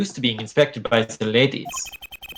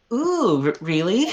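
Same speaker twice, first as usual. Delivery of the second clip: high, dreamy ooh; swooning girl; 7 semitones higher, slower than usual. Acted. Girl swoons at the charmer, higher. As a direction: melt a little at the compliment.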